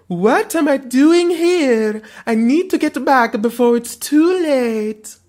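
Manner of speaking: loud, high, slow hammed-up French accent. Dream woman realizes she's in the wrong place, French accent. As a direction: cry out urgently with an attempted French accent. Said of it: "I will attempt a French accent".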